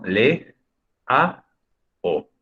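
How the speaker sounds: "Le, a, o" as three separate beats; teacher's spaced-out split of Leao; syllable by syllable. Shown as a demonstration. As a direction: pause after each syllable of "Le, a, o". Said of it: "Cómo sería el silabeo de Leao".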